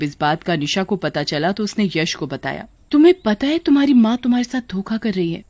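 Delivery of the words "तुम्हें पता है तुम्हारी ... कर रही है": higher, rapid warning to husband; urgent wife. Acted. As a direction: break bad news in a rush. Wife warns husband of deceit. (पति को चेताती पत्नी, तेज़ और ऊँचे स्वर में)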